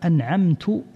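أنعمت ending in u, "an'amtu"; damma on the ta. Shown as a demonstration. Teacher demonstrating a misreading to listeners, أنعمت said "an'amtu". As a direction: say أنعمت with -tu instead of -ta at the end. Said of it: "ضم حرف التاء".

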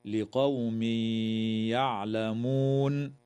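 tanween of لقوم merged into يعلمون with a nasal hum; idgham with ghunna. Shown as a demonstration. Teacher showing the merge to students, slow chant. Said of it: "ويدغم التنوين في الياء مع الغنة".

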